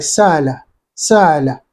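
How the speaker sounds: "sala" with the glottal stop smoothed into a long vowel; lightened hamza. Shown as a demonstration. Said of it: "You lighten the Hamza".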